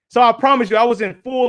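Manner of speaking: ordinary talk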